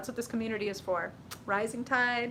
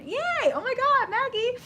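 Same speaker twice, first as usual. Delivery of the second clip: much higher, louder yay of glee; delighted squeal; 7 dB louder than usual. Real, not acted. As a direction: squeal at the viewer's name. Reacting to a viewer's comment.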